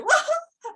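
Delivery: sudden high-pitched "wah" wail; mock crying wail. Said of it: "towards the end, I just went".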